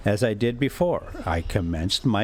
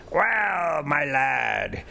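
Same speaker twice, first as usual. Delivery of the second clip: higher, hearty exclaiming uncle; jolly professor calling out; cried out; 4 semitones higher, much slower than usual. A narrator's reading. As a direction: call out gleefully to the lad. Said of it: "he cried".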